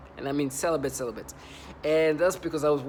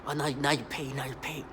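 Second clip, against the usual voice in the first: mocking threat; 3 semitones lower, 4 dB quieter than usual. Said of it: "they just".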